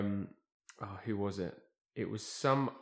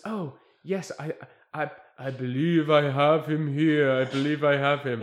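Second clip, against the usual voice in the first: mystic medium; 4 semitones higher, 8 dB louder than usual. Retold or imitated. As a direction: announce the spirit's arrival with ceremony. Medium channelling the spirit.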